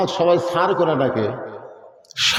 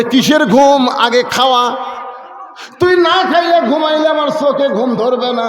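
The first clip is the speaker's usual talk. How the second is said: higher and louder, fussing; eager sister; 6 semitones higher, 3 dB louder than usual. Acted. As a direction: dote loudly on your brother. Wife fussing over her brother.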